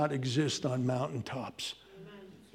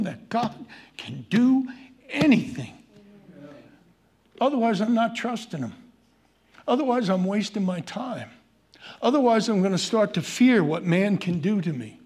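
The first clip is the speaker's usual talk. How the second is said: higher, louder preaching climax; full-force preaching; 4 semitones higher, 3 dB louder than usual. Acted. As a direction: build the sermon to a ringing peak.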